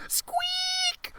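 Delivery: tiny, very high squeak; mouse squeak. Acted. Trapped mouse squeaking in fright at the dragon.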